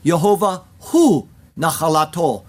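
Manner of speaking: "hu" stressed and set apart; emphasis on hu. Shown as a demonstration. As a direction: punch "hu" between small pauses in the Hebrew. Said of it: "There is again an emphasis".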